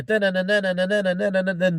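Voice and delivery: vocalized theme tune; imitating the music. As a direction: hum the tune out in dun syllables. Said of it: "The music".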